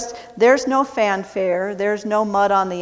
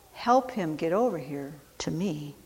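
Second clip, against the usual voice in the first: much softer, gentle echo of the command; soft repeat; 3 semitones lower, 9 dB quieter than usual. Acted. Jesus gently asking the crowd to help, soft. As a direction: say it again, barely above a murmur.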